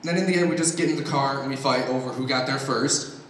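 ordinary delivery

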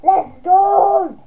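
loud high victory yell; excited cheer. Real, not acted. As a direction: cheer the play. Cheers after trick.

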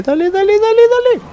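rising to a high squeal; building from urging to shrieking; excited urging. Real, not acted. Urging boat on in fight.

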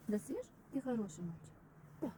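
soft, tender coaxing; talking to a dog. Real, not acted. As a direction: coax the dog gently.

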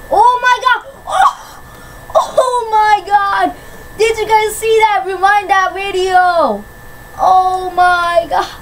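high shrieking joy, nearly crying; ecstatic screaming. Real, not acted. Gamer shrieking at a triple kill. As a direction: scream it like you can't believe it. Huge kill streak in game.

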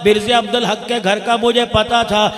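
loud, fervent sermon storytelling; full-force preaching. Acted. Preacher tells the crowd the story, fervently and loudly. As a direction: drive the story home to the crowd.

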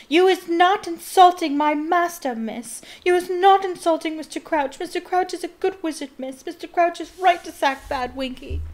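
high, loud, squealing elf voice; screeching house elf. A narrator's reading. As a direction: shriek it out like a distraught little elf defending her master. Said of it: "and screeched".

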